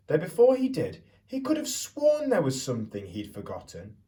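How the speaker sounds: put-on lively storyteller voice for children; picture book read-aloud. A narrator's reading. Teacher reading Stuck to the class, storytelling voice.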